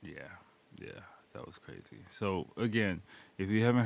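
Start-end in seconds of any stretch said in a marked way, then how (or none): 0.0-2.0 low drained whisper. Real, not acted. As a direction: mutter it under your breath, spent. Aftermath of the sour candy.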